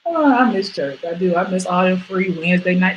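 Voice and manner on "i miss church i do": ordinary talk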